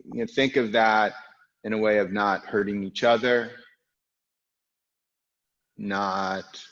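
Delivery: normal talking voice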